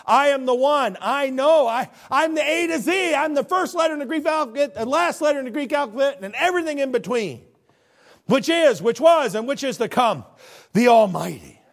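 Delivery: God speaking with authority, booming. Retold or imitated. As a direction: declare it like God the Father. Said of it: "he is speaking with authority".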